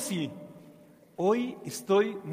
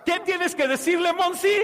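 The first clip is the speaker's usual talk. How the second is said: urging shout; 8 semitones higher than usual. Acted. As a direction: fire the kids up. Urging child to refuse devil.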